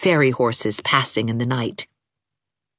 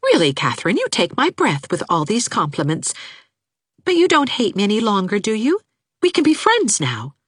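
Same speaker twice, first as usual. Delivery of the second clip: put-on bright, hopeful young woman; 7 semitones higher than usual. Anne teasing, hopeful.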